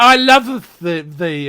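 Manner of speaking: loud, lightly hammed-up Romeo; mock Romeo. Smitten man wooing Supergirl, mock Romeo. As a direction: declare love like a stage lover, mockingly. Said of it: "he starts talking like Romeo".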